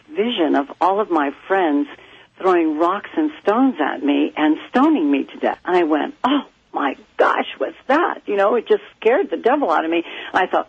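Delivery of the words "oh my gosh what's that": high, startled cry of alarm; a child's alarmed exclamation, lightly voiced; startled gasp. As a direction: recall the sudden fright in a raised voice. Child sees frightening vision.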